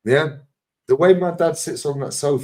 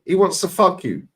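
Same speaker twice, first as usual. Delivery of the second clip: ordinary talk, nothing marked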